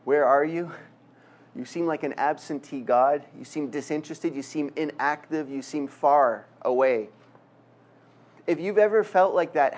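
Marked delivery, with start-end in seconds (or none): none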